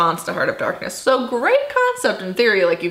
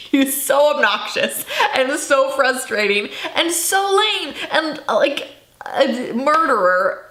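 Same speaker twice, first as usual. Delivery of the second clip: exasperated, high and slow, still laughing; exasperated rant; 6 semitones higher than usual. Real, not acted. Complaining to viewers about an obnoxious protagonist, higher and exasperated. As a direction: pile on the complaints in disbelief.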